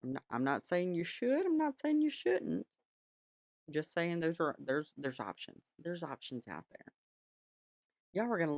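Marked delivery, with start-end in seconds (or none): 1.5-2.7 high, sly and teasing. Pretend. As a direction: wink it at the viewer, sing-song high. Coy disclaimer, then sly reversal.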